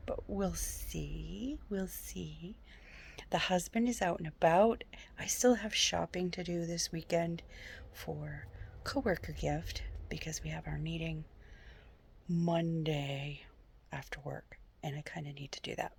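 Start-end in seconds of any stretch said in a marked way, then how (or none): 0.1-1.6 drawn-out, slow and soft; slow trailing off. Real, not acted. A slow shrug over her reading goal. 1.7-2.5 breathy unvoiced hush; whisper. Real, not acted. Whispering the same doubt to herself. 5.2-15.9 soft unvoiced whisper, quick at the end. Real, not acted. Whispering her weekend shopping to the camera. Quiet talk while alone at home.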